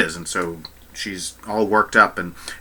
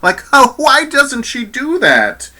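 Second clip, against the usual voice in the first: much higher and louder, slow and pleading; exasperated; 4 dB louder, slower than usual. Real, not acted. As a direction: plead in disbelief. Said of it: "frustrated about missing high note".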